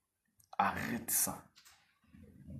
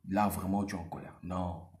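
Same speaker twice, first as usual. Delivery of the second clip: usual speaking voice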